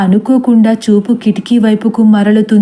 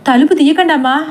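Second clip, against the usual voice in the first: higher, urgent plea; mild shout; 5 semitones higher, faster than usual. A narrator's reading. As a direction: beg her not to open the door. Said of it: "సారథి గట్టిగా అరుస్తాడు".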